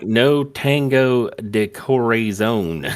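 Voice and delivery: slow, exaggerated bad Spanish accent. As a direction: drag it out in a terrible Spanish accent. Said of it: "in the worst Spanish accent ever".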